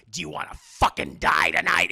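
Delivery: loud mock killer threat; aggressive. Killer threatening her by phone, aggressive. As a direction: erupt at her like a phone villain. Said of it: "eventually he starts getting, like, aggressive".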